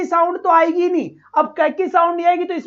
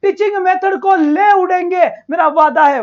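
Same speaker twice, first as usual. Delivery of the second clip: usual speaking voice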